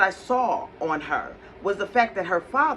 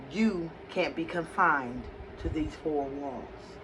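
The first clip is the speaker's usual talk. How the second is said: lower, softer, slow and solemn; solemn prophetess; 5 semitones lower, 8 dB quieter than usual. Retold or imitated. Prophetess telling the speaker her calling, low and slow. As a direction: deliver it like a quiet prophecy.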